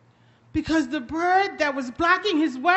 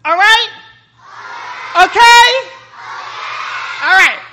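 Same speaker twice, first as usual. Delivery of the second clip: loud call to the crowd; outside voices; 5 semitones higher, 10 dB louder than usual. Real, not acted. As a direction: shout it out to the audience. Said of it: "Outside voices".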